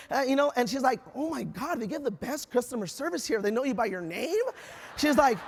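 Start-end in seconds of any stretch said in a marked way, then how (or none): 1.1-4.4 softer, mock-impressed aside; hushed amazement. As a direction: imitate a guest quietly marveling at the service. Connie imitated, impressed by staff.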